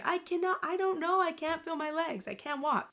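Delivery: high, frantic mock protest; retold yelling. Daughter explaining her collapse to family, retold yelling. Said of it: "I'm yelling at my family".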